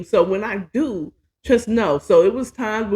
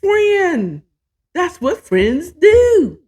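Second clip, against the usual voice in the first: higher, slow, eager cartoon donkey; a slow, sing-song Donkey impression, pitched up; Donkey from Shrek; 11 semitones higher, 5 dB louder, much slower than usual. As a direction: ham it up like the pushy sidekick Donkey. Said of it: "I'm Donkey that comes in like".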